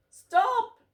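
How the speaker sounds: high, loud yell of stop; cat yelling at the hose spray. A narrator's reading. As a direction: cry out as the water hits. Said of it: "yelled Goliath".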